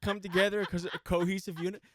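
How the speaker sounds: a man at his wits' end addressing "guys"; exasperated customer. Retold or imitated. Man at his wits' end yelling to the whole restaurant, exasperated. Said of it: "He's just like".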